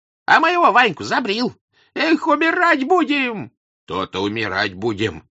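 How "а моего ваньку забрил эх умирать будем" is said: put-on very high, loud old man's wail; anguished old man's outcry; little old man lamenting. A narrator's reading. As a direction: cry it out high, drawing out the last words. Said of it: "заговорил маленький старичок, нападая на Дрона".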